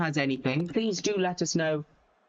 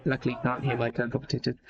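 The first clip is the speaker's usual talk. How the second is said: usual speaking voice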